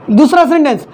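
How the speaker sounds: ordinary talk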